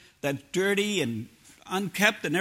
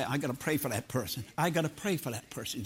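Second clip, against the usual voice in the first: softer, lower, earnest murmur to oneself; mild concern; 5 semitones lower, 5 dB quieter than usual. Acted. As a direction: voice a quietly concerned believer. Models concern for a struggling person.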